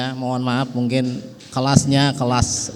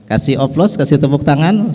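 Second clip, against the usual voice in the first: louder, rallying the crowd to clap; calling for applause; 4 semitones higher, 8 dB louder than usual. Real, not acted. Host urging the audience to applaud, loud rallying call. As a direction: call out to the hall for a round of applause.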